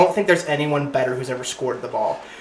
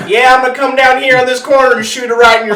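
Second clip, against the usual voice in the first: put-on raised, loud country-accented boast; cocky country trash talk, pitched up and loud; Larry Bird's country accent; 7 semitones higher, 5 dB louder than usual. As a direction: call your shot in a mock country accent. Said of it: "has this country-ass accent".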